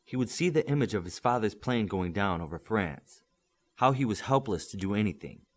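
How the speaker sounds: ordinary delivery, nothing marked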